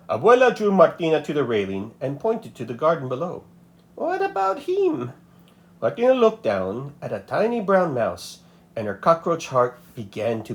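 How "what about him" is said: put-on much higher old grandmother voice. A narrator's reading. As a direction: sound like a kindly old abuela.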